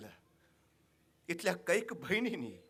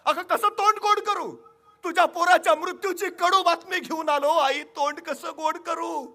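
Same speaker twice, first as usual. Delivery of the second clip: loud and much higher, grief-stricken cry; anguished king; 13 semitones higher, 14 dB louder than usual. Acted. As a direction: cry out the bitter news, slowing at the end. King carrying news of death. (दुःखाने भरलेला मोठा आवाज)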